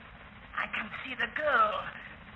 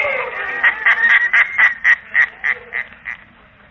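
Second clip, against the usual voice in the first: long loud cackle, much higher, dying away; a madman cackling at the thought of dying together; 10 semitones higher, 10 dB louder than usual. Acted. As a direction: throw back the head and cackle. Madman laughs over dynamite.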